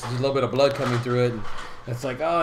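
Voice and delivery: usual speaking voice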